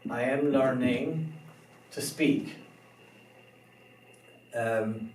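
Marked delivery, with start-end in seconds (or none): none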